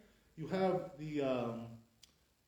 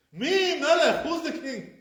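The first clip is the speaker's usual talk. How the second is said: high, loud mock question; incredulous shout; 10 semitones higher, 9 dB louder than usual. Teacher asking angels absurdly, loud incredulous shout.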